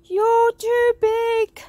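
high, loud, stretched-out warning; alarmed cry. A narrator's reading. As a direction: shout it slowly across the room. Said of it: "cried George".

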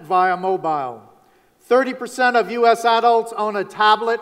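punchy, declaimed year-in-review headlines; measured newsreel-style announcing with pauses between items; headline recital. Acted. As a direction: proclaim each item as a separate headline.

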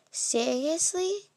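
long amazed question; stretched disbelief. Acted. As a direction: drag it out in delighted disbelief. Amazed at coincidence.

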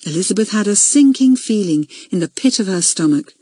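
plain reading voice

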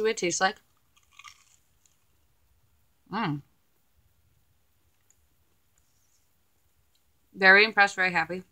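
chewing while speaking; muffled through a mouthful of food; mouth full. Real, not acted. Seen 0: Bites food at -3, cheeks bulge chewing throughout the words.

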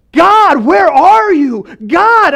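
high, loud, drawn-out cry; shouting out. Acted. Habakkuk at breaking point calling to God, desperate shout. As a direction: shout it upward, stretching every word. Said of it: "he starts shouting out to God".

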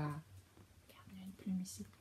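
whisper. Real, not acted. Parent shows the child the feather, whispering. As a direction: breathe it like a secret. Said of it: "pointing out a hidden feather".

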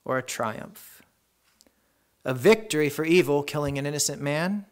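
normal talking voice

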